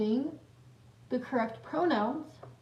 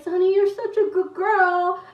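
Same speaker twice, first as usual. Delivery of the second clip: high, louder mock pet talk; dog-praising voice; 7 semitones higher, 5 dB louder than usual. Sisters praising the dog to annoy mom, teasing pet talk. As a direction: gush at the dog to tease.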